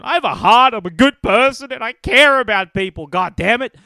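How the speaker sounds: high, loud protesting voice; rising indignant yell that you're a good person; indignant outburst. Real, not acted. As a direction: shout your goodness at them. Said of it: "I have compassion".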